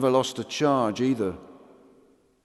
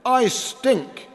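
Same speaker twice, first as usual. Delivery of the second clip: much higher, proud shouted boast; loud; 13 semitones higher, 4 dB louder than usual. Acted. Bradshaw boasts he stinks, loud proud shout. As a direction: bellow it out proudly.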